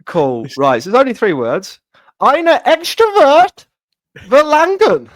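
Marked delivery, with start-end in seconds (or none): 2.2-5.1 slow, high put-on German accent; exaggerated German, drawn out high. Shown as a demonstration. Hammed-up German accent for the co-hosts. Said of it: "do it in my German accent".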